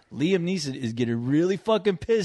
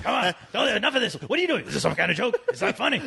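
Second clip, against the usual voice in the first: higher, exasperated movie hero; Liam Neeson impression; 6 semitones higher, faster than usual. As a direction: plead angrily like a wronged hero. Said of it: "that's a good Liam Neeson".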